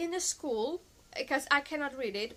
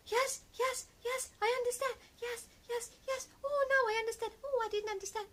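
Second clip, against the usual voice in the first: much higher, timid and placating; imitating a cowed doctor, pitched up; shrinking, meek; 9 semitones higher than usual. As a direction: play a big man who suddenly feels tiny and says yes to everything. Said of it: "he got so small".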